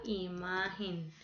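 imagen said softly and slowly, MA held; slow, falling imagen with a long MA; stress on MA. Shown as a demonstration. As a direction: quietly sound out imagen, lingering on MA. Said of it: "Tiene la sílaba tónica en ma".

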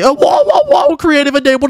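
amazed, excited discovery; stunned whoa. Real, not acted. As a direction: gasp it out in disbelief. Discovering creative mode in game.

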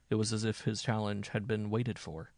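ordinary delivery

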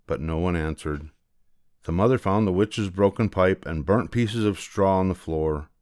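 ordinary delivery

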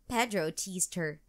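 plain reading voice

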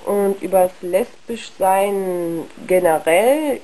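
ordinary talk

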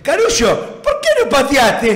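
"Carullo" pronounced with sh instead of s; Nene Gómez's sh. Acted. The ten asking why he didn't shoot, "Carullo" with a sh. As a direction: mimic a teammate's mushy "Carullo". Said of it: "porque hablaba con la Elle".

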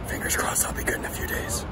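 soft, whispery lost voice; voice nearly gone. Real, not acted. Traveler hoping to be good in days, voice gone. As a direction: whisper it out with no voice left. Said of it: "That didn't sound good".

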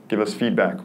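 ordinary talk

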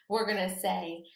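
ordinary delivery

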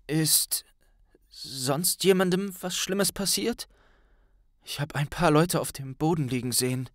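weak, pressed voice, starting in a whisper; a sick boy forcing words out; strained. Acted. Harry asks his friends weakly from the sickbed. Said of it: "antwortete Harry gepresst".

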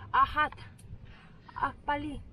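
ordinary talk, nothing marked

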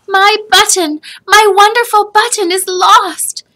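put-on high, loud wailing child; a boy in panic over his loss; distraught child. A narrator's reading. Joseph cries out to his grandfather in distress.

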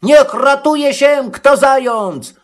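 high-pitched alarm call; drawn-out, high warning to fellow hares; sudden cry. A narrator's reading. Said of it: "Nagle przerwał i zakrzyknął".

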